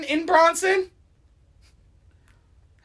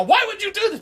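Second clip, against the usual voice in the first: accusing mock question; 8 semitones higher, faster than usual. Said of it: "as in, like, a".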